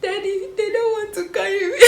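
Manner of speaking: crying to her dad on the phone, half laughing; mock crying. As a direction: replay the tearful call with a laugh breaking through. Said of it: "I started crying".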